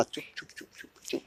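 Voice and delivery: breathy whispered shushing; whispered hush. Real, not acted. Father whispers to hush his crying child.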